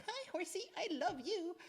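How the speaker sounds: very high, sugary false greeting; fake sweetness. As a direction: gush falsely at a horse in a high voice. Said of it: "What they don't like is if you're dissembling".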